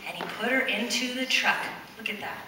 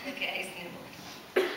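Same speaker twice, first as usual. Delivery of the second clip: laughing whisper; 4 semitones lower, 12 dB quieter than usual. Real, not acted.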